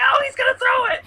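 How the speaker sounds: high excited scream of disbelief; reenacted screaming. Fan reliving the trick play to his cohost, high excited scream. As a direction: yell it like the trick play is unfolding live. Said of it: "And then I was just screaming".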